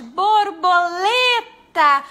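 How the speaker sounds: Borboleta with the stressed syllable LE drawn out long and loud; teacher stretching the stressed syllable for children; stressed syllable stretched. Shown as a demonstration. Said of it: "Onde é que a prof esticou mais".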